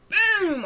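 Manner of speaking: a sudden shouted boom as the door gives; loud boom. Retold or imitated. Telling how she kicked in the door, a shouted boom. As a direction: slam the sound out high and loud.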